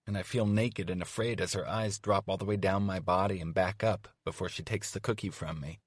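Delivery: plain reading voice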